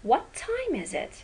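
"What time is it" starts high on "time" and falls away; falling tune. Shown as a demonstration. Teacher demos a falling wh-question tune. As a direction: drop the voice down after "time".

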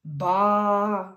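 long picture-book sheep noise; sheep bleat. Acted.

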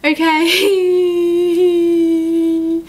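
long high whine on one word; frightened squeal. Real, not acted. Reader rattled by the scare, long frightened squeal. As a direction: stretch it into a thin, scared squeal.

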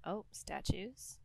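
quiet and slow, barely voiced; hushed. Real, not acted. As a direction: murmur it, wary of the dark. Spooky maze, creeping along.

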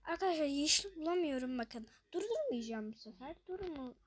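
normal talking voice

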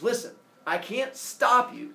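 a parent's warning, drawn out on "stop"; acted stern warning. Helios warns Phaethon in myth retelling.

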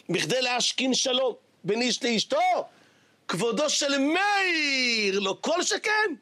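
slow, breaking with tears; tearful rabbi. Acted. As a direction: plead the rabbi's point through tears. Rabbi answers tearfully, slowly.

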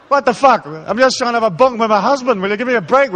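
loud, high-pitched comic outburst; exasperated. Acted. Spouse fed up with rules during lovemaking, mock exasperation. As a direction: blurt it out, fed up and pleading.

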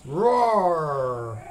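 long, open playful "roar"; tiger roar. Pretend. Quiz on tiger sound.

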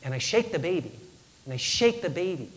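grave dread. Retold or imitated. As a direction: let the dread sit between phrases. Baby not breathing, worst moment.

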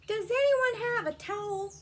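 high-pitched character call. A narrator's reading. Muddy Ricky asks for a towel, high call. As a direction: call out for help to anyone nearby.

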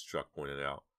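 plain reading voice

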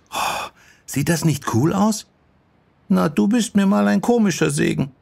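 put-on high, eager girlish voices; enthusiastic young girls. Sachiko shows Yoko the new building, excited. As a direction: gush and tease in young voices.

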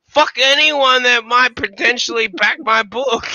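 hammed-up ranting, slamming each curse; mock angry rant. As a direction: imitate a man cursing everyone in turn. Mock tirade quoting an angry man.

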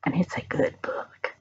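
breathy unvoiced aside; whispered confidence. Real, not acted. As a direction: lean in and whisper it.

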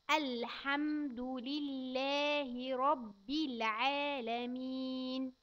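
put-on every syllable drawn out for a child; very slow recitation. Shown as a demonstration. Teacher reciting very slowly for a child. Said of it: "تاني".

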